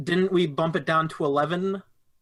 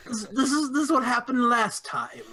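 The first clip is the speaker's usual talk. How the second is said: put-on higher, creaky old man voice; 9 semitones higher than usual. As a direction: speak like a frail old man. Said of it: "why do you still have the old man voice".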